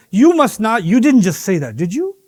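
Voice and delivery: ordinary talk, nothing marked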